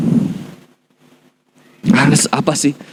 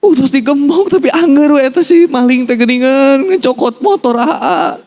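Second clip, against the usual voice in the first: mock wail in a much higher voice; stressed mother; 10 semitones higher than usual. Mother complains to her son about the thief, stressed wail. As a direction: imitate a distraught Sundanese mother, high-pitched. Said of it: "ibu saya stres itu".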